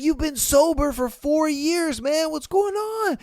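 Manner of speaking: put-on a friend startled at the drunk guy; worried friend. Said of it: "He's like".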